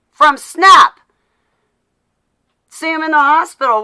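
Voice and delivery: venting furious disbelief at the politicians; heated rant. Real, not acted. Ranting to livestream viewers, loud and indignant.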